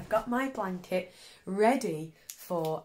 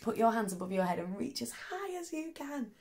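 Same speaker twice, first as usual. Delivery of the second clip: usual speaking voice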